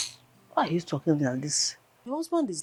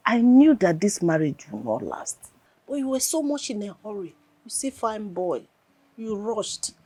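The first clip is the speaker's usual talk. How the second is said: raised, louder I-told-you-so; scolding reproach; 4 semitones higher, 8 dB louder than usual. Acted. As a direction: chide her for not listening. Friend scolds about the marriage.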